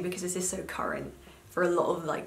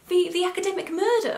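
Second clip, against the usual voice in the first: high, louder mum voice; 12 semitones higher, 7 dB louder than usual. Retold or imitated. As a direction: pitch up to play your mum. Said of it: "She was like".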